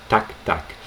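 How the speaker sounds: ordinary talk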